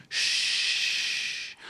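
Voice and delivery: whispered hush from the crowd; shushing. Acted. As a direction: hiss a sharp shh. Crowd hushing the father.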